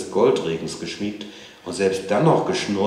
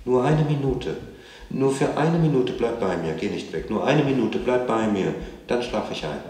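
lightly voiced child, begging in a higher pitch; pleading child; 6 semitones higher than usual. A narrator's reading. Child begs mother to stay.

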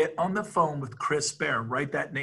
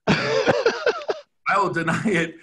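slow, louder, laughing through the words; laughing denial; 5 semitones higher, 6 dB louder, much slower than usual. Real, not acted. Speaker to agents on Zoom, joking denial, laughing and slow. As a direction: laugh it off while denying. Joking he'll deny the promise.

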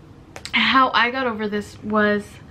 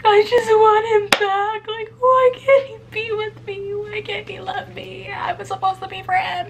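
much higher, aching plea for him back; pining heartbroken voice; 10 semitones higher than usual. Retold or imitated. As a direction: play the lovesick girl begging to have him back, pitched way up. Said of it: "there's days where it's like".

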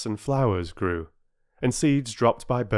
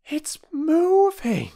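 cry; 5 dB louder, slower than usual. A narrator's reading. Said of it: "cried Bobby".

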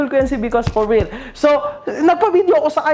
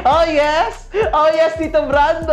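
gloating laugh; 5 semitones higher than usual. Real, not acted.

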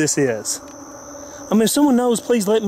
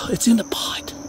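whispering; 4 semitones higher than usual. Real, not acted. Creepy ritual scene, hushed.

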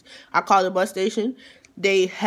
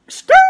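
tire screech sound effect; 13 dB louder than usual. Retold or imitated.

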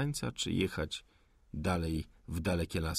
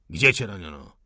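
a hard, loud question; loud interrogation; 2 semitones lower, 8 dB louder than usual. Acted. The officer grills the wounded rebel, loud and hard. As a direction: push him for an answer.

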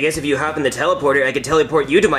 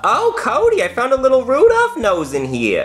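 high, eager mother's voice; high doting mom voice; 9 semitones higher than usual. Acted. Mom on the phone to her son Cody, high doting voice. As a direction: chirp it up like a fussing mom. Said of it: "Mom, now".

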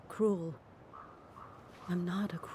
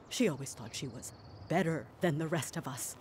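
ordinary talk

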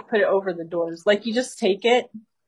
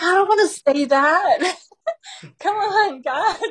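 high, louder whiny protest; mock whine; 11 semitones higher, 3 dB louder than usual. Jeremy refusing God's instruction, mock whine. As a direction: whine it like a scared kid refusing.

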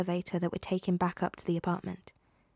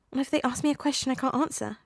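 higher, whiny worry; anxious teen pleading to his mentors, higher whine; whining; 6 semitones higher than usual. A narrator's reading. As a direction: whine it like a nervous kid. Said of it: "Peter whined".

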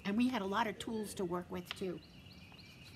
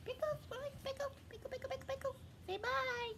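much higher, softer puppet voice; Pickles puppet voice; 7 dB quieter, faster than usual. Acted. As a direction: squeak it like a little puppet. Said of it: "Pickles likes to say goodbye".

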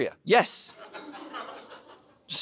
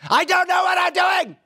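high, loud exasperated holler; full-force outburst from a retold marital fight; reenacted yell; 7 dB louder than usual. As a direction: yell it out as a helpless confession. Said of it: "I just yelled out loud".

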